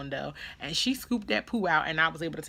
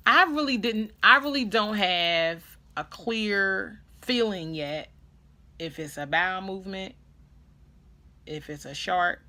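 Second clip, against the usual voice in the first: ordinary delivery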